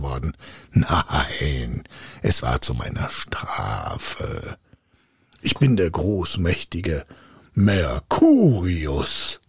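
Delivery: low, slow, grandiose spirit; pompous, threatening giant; menacing spirit. A narrator's reading. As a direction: intone it heavily, stretching key words. Mighty spirit threatens pupil.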